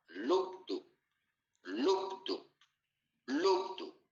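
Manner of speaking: "lub-dup" heartbeat imitation, spaced out; heart-sound onomatopoeia. Shown as a demonstration. Teacher showing students how the heart sounds, imitated heartbeat. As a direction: imitate the two heart tones as separate beats. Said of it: "la onomatopeya de los tonos cardíacos".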